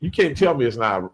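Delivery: ordinary talk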